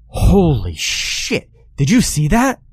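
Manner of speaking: high-pitched yell of disbelief; startled yell. A narrator's reading. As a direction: yell it out, drawing out the curse, voice leaping up. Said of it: "he yelled".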